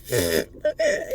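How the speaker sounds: sudden high burst of laughter; mock laugh at someone falling. Laughing at a buddy who fell on his ass, high burst of laughter. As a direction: cackle at a friend who just fell. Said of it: "I might laugh for a minute. One of those".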